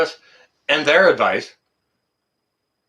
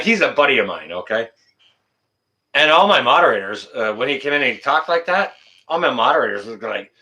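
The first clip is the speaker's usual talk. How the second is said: ordinary talk, nothing marked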